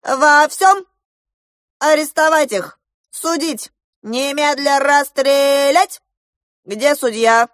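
loud, high barked orders; furious general. A narrator's reading. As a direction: rap out each sentence in rage. Furious general orders arrest and execution.

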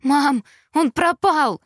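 frantic, slow and loud, high; panicked. A narrator's reading. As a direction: blurt it out in panic. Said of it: "судорожно произнесла я".

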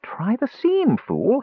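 high-pitched, severe self-scolding. A narrator's reading. Kender scolding himself as he nibbles fabric, severe. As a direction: scold yourself sharply in a high voice. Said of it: "he scolded himself severely".

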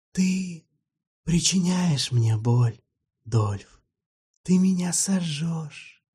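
a hint of a cold, grieving undead friend; sad vampire. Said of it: "сказал грустно". (грустный вампир, голос чуть выше и медленнее)

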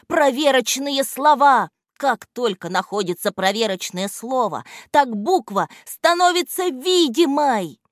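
loud, high, joyful explanation; радость. A narrator's reading. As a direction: announce the discovery happily. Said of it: "продолжал радоваться Антон". (радостно и громко)

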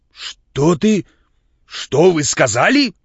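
громко и медленно, изменённым голосом; наливаясь гневом, переспрашивает; багровеющий гнев. A narrator's reading. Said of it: "багровея". (возмущённый вопрос, громко)

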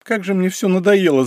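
high, whiny complaint from the back seat; whining. A narrator's reading. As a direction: whimper it like a tired child. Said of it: "захныкала с заднего сиденья Тася".

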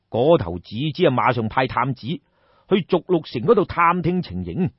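ordinary delivery, nothing marked

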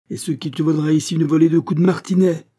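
high, loud menace; threatening. Acted. Abbé threatening Pitou with the whip, loud menace. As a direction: promise the whip.

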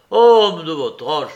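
loud mocking shout on "Homme"; a loud, scornful cry. A narrator's reading. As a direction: yell it back at him. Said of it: "cria l'autre".

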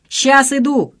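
loud, high shout from a window; calling down. A narrator's reading. Son answering friends below, shouting. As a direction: call out that you're on the way. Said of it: "крикнул вниз Саша".

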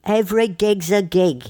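slow, high rock star drawl; [name]. Retold or imitated. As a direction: do a Jagger impression. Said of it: "[name] says".

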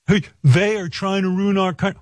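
a hammed-up whine of an entitled star; mock entitled actor. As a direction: parody a self-important celebrity. Mocking Ricky Schroeder's rant.

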